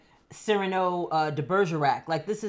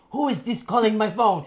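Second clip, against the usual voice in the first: lightly voiced African accent on a prank call; a hint of an African accent, asking who called; 2 semitones higher, 3 dB louder than usual. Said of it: "in an African accent".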